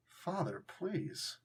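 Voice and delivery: soft plea. Retold or imitated. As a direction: beg quietly, almost under breath. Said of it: "the idea they're just looking is like".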